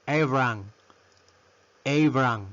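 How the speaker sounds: Abraham squeezed to "AY-bran"; Abraham as a careful two-beat "EI-bran"; English name pronunciation. Shown as a demonstration. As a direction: model Abraham with "ay" at the start. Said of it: "pronunciación es Abra".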